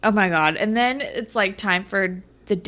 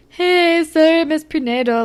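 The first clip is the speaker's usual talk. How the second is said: higher, louder put-on greeting; Casey; 8 semitones higher, 5 dB louder than usual. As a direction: imitate someone breezing up to apologise. Voicing Casey approaching.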